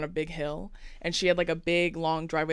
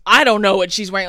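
loud, dismissive mock cop reply; rude, unhelpful cop; 12 dB louder, faster than usual. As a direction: play a rude officer brushing someone off, loudly. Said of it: "being so unhelpful and rude".

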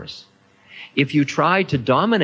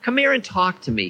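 put-on delighted old man; 11 semitones higher than usual. A narrator's reading. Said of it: "beamed delightedly".

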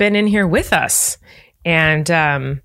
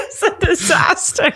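laughing. Real, not acted. Laughing at the mess.

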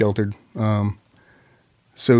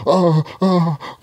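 high, startled oh oh; mock alarm; 6 semitones higher than usual. Recruit startled by the dripping pad, high. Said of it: "and she's like".

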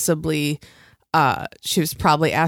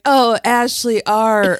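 drawn-out, higher fake moan; mock lover's cry; 4 semitones higher, 4 dB louder, slower than usual. Reality contestant in fantasy suite, mock moan. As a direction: moan the name slowly, in jest. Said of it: "they're fucking in the fantasy suite and they're like".

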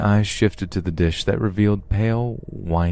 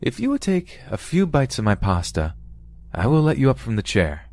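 higher-pitched, bargaining offer; raised questioning tone; 7 semitones higher than usual. A narrator's reading. Captor bargaining with captive over pasta, tone raised. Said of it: "His tone raised to signify a question".

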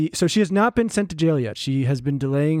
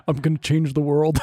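lightly put-on founder voice; mock founder voice. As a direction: playfully imitate an earnest founder. Joking imitation of Holmes's fake voice.

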